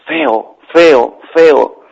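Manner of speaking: drawn-out cave echo; ringing, spaced echo; echoing tunnel voice. A narrator's reading. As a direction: repeat it like a voice bouncing back. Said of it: "del túnel salió una voz".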